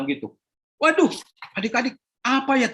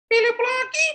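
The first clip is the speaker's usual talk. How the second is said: put-on high childish whine; sulky boy. Philip complaining to his mother about errands, high whiny child voice.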